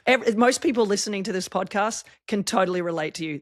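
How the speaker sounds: normal talking voice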